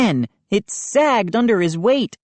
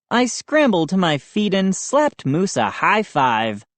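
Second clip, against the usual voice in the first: usual speaking voice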